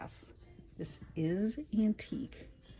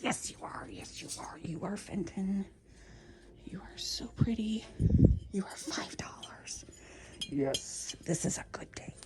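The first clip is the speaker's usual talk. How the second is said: excited whisper; 3 semitones higher than usual. Real, not acted. Shopper whispering to a Fenton vase, excited whisper. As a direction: whisper like it might run away.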